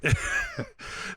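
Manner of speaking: a high squeak of laughter; a burst of high laughter; real high-pitched giggle. Real, not acted. Laughs at no bene.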